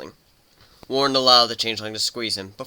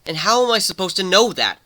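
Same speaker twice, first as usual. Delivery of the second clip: higher, louder challenge; challenging question; 9 semitones higher, 6 dB louder than usual. Acted. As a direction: throw the question back at her. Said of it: "staring at her challengingly".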